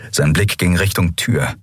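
plain reading voice

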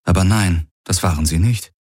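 ordinary delivery

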